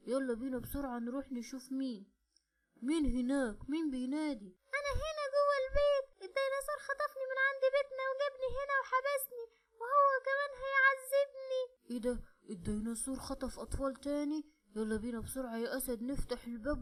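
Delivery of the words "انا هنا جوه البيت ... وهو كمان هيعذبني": put-on high, loud little girl's cry for help; a child locked inside shouting to be rescued; trapped little girl. (بنت صغيرة محبوسة بتنادي بصوت عالي عشان حد ينقذها)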